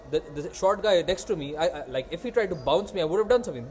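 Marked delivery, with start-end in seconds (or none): none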